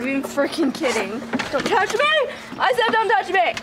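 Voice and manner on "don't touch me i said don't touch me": high, raised cry to keep off; don't touch me shout. Real, not acted. Owner shouting at her dog to keep off, high and raised.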